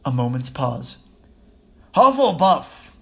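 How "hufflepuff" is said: loud, high, slow announcing shout; the hat's ringing shout. A narrator's reading. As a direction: shout it out, drawn out. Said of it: "shouted the hat".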